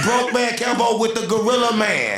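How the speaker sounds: high, slow mocking yell; mocking shout.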